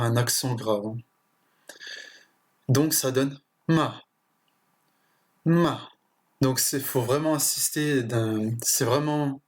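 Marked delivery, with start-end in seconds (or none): 3.7-4.0 ma short and falling sharply; fourth tone ma. Shown as a demonstration. Teacher demonstrating Chinese falling fourth tone. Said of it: "un ton descendant et bref". 5.5-5.9 ma short, falling hard from high; fourth tone ma. Shown as a demonstration. Teacher demonstrating Chinese falling fourth tone. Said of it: "un ton descendant et bref".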